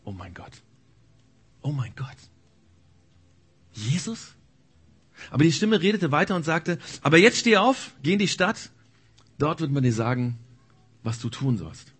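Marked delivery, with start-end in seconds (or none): 0.0-4.3 stunned whisper. Acted. Saul stunned, inner thought. 7.0-11.9 commanding divine voice. Acted.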